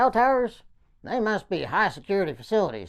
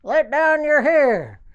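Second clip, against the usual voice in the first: loud, higher, slowing call; yelling up; 7 semitones higher, 9 dB louder, slower than usual. Retold or imitated. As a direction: bellow it up to whoever is on the tower. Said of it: "So I yelled".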